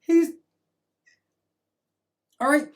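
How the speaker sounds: ordinary talk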